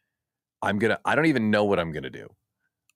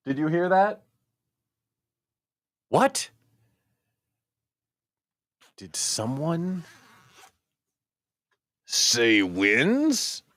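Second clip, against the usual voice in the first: mock dramatic; 7 semitones higher, much slower than usual. Mock dramatic call for wins.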